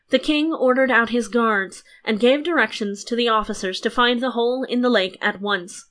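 ordinary delivery, nothing marked

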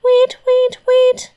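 very high, short piping bird calls; high sung birdcall; nightingale song. Acted. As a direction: chirp it like a nightingale on one high note. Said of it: "changed into a nightingale and sang".

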